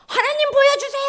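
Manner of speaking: high, loud pleading cry to God; pleading prayer. Acted. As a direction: beg God like a desperate child.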